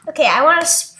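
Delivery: normal talking voice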